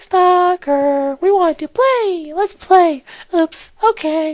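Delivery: put-on very high, slow creepy child voice; sing-song like a haunted kid. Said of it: "To the creepy child voices".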